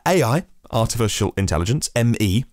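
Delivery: ordinary talk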